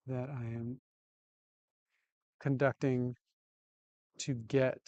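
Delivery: ordinary talk, nothing marked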